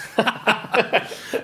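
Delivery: a short airy laugh; a quick unvoiced chuckle; breathy laugh. Real, not acted. Laughing at sister's reply.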